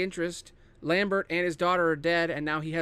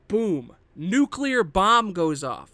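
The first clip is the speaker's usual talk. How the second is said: slow, louder, punched-out reveal; dramatic boom; 3 semitones higher, 7 dB louder, much slower than usual. Real, not acted. Pitching the movie's opening to viewers, slow dramatic trailer punch. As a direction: land the explosion like a movie trailer. Describing the game's shocking opening.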